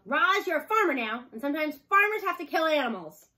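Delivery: an angry farmer yelling at his robot; furious yell. A narrator's reading. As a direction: shout her down in anger. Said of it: "Mister Sharif ever yelled at Roz".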